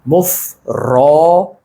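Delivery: मुफरोदात read out slowly, syllables stretched; drawn-out recitation. Shown as a demonstration. Teacher reads the lesson title मुफरोदात, slowly drawn out. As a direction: stretch the long vowels of मुफरोदात. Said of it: "तख्ती हमारे पास क्या है".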